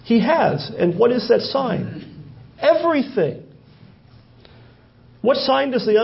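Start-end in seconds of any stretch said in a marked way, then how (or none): 2.6-3.4 preacher's climactic high Everything; emphatic reveal. Acted. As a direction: land Everything as the big answer.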